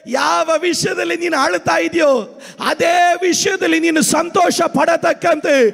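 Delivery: high, impassioned sermon cry; full-force preaching. Real, not acted. Pastor telling the weeping that God will act, full-force preaching. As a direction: proclaim it to the congregation with fervour.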